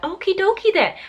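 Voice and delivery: put-on weak, high Minnesota accent on okie dokie; a self-admittedly bad Minnesota accent. Mimicking Donna's catchphrase, failed high Minnesota accent. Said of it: "I can't do the Minnesota accent".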